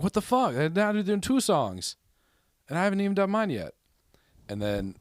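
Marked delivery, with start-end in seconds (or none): 0.0-3.7 high-pitched, indignant protest; acted-out fed-up complaint; exasperated outrage. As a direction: retell a grievance with rising, squeaky exasperation. Retelling his outrage at queue jumpers.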